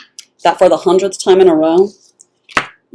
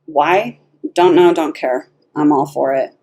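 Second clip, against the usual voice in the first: usual speaking voice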